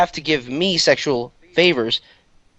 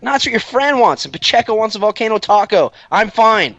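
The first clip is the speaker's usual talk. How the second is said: high, raised insisting voice; re-enacted calling back; 8 semitones higher, 3 dB louder than usual. Salesman answering the kids in the car, calling back. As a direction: call it back, pitched well up.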